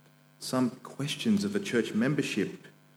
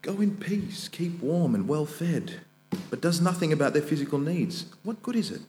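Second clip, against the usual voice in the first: scripture reading; 3 semitones higher, 4 dB louder than usual. Acted. Preacher reads scripture aloud.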